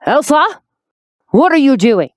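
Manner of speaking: loud, high alarmed shout; calling out. Acted. Man behind the door shouting at Elsa. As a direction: yell through the locked door. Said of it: "A male voice called out from behind the door".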